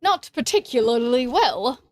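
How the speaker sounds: put-on, exaggerated British accent. Shown as a demonstration. Streamer asked for an accent answers in hammed-up British accent. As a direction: have a go at a bad British accent. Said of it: "That's my British accent".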